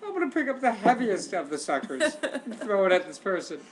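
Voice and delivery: high, loud mock thinking voice; mock inner voice. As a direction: play the fruit-picking thrower, hammed up. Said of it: "Who goes to the kitchen and goes".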